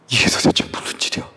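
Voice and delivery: quick, hushed whisper; astonished. Acted. Disciples stunned by the calmed storm, whispering in astonishment. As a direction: whisper it fast in acted shock. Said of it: "너무 놀랐어요".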